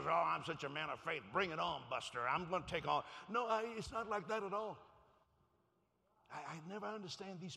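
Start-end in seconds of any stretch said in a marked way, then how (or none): 0.1-3.0 loud mock bravado; put-on swagger; mock bragging. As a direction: boast it like a cocky man of faith. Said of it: "I can't brag and say".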